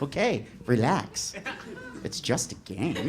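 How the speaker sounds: soft, slow faux-soothing; mock-calm him down; little brother turning the taunt back; smug calm. Brother mocking calmly.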